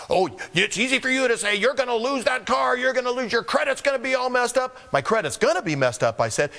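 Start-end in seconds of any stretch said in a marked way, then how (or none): 0.1-4.8 higher, mock-indignant nagging; a touch of a scolding bill collector; lightly voiced pushy collector.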